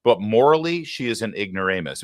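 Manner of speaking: normal talking voice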